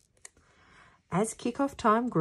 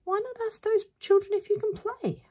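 much higher put-on mum voice; mum's voice. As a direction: suggest it as a high-voiced mum. Mum's line in story, high voice.

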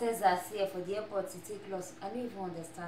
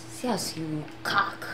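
sly whisper; 3 semitones lower, slower than usual. Acted. Sly remark about the lady.

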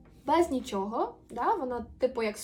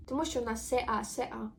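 normal talking voice

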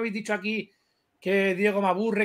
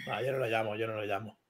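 sheepish refusal; 10 semitones lower, 10 dB quieter than usual. Real, not acted. Backing down, muttered refusal.

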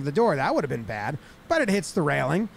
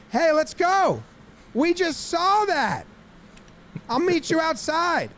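high-pitched mock tough guy calling out; hammed-up fighting talk; fired-up challenge; 10 semitones higher, slower than usual. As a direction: call them outside, high and heated. Acts out pitcher picking a fight.

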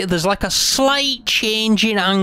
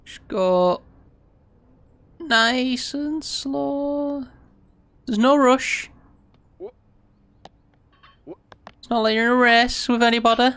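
mock soothing; 6 semitones higher than usual. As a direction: talk yourself down like calming a child. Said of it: "I'm spazzing out a little bit. I need to, to take a breath".

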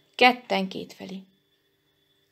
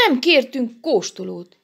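higher, louder protest; indignant scolding; 7 semitones higher, 4 dB louder than usual. A narrator's reading. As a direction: scold them with rising pitch. Elemér's indignant scolding reply.

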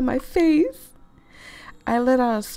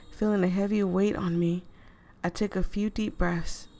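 ordinary delivery

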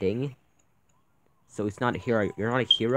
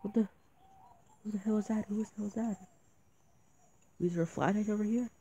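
scared whisper-like; 8 semitones higher, 5 dB quieter than usual. Real, not acted. Creepy figure appears on screen.

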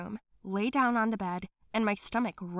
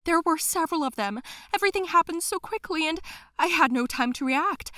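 higher, breathless and panicked; frightened nanny; 8 semitones higher, 5 dB louder than usual. Acted. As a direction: spill it all out in a panic. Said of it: "barely able to catch her breath".

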